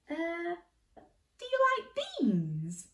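high, slow hopeful question; Jack asking hopefully. A narrator's reading. Jack hopefully offering beans to the giant. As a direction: ask it with bright hope.

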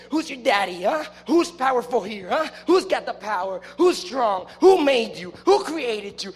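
cocky challenge building in force; demoralizing taunt. Acted. Movie fighter reminding opponents who has the power, taunting. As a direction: hammer each question at a beaten opponent. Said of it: "You remind them, you're saying".

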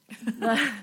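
short uneasy laugh; a quick nervous chuckle. Real, not acted. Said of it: "I'm laughing like a nervous laugh".

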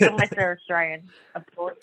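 ordinary delivery

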